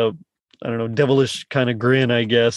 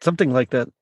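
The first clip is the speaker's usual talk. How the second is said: usual speaking voice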